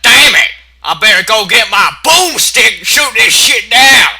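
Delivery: high, loud hammed-up cowpony yell; angry pony shout. Pony fed up with the tree, hammed-up loud yell. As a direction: bellow it like a fed-up farm pony. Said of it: "It's like".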